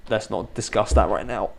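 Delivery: normal talking voice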